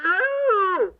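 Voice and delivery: sudden high startled oh. Acted. Old doctor startled by a visitor at his door, sudden high oh. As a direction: caught off guard at the door.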